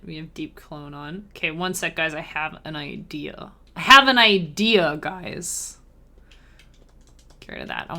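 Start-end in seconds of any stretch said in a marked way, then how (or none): 2.1-3.5 soft, muttered to herself; quiet murmur. Real, not acted. Idea said twice. 3.7-5.8 louder, excited call to the viewers; loud announcement. Real, not acted. Idea said twice.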